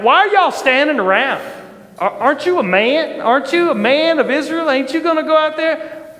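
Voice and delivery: high-pitched, goading questions; incredulous challenge. Retold or imitated. David to soldiers standing around, incredulous challenge. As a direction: imitate a youngster egging on the men.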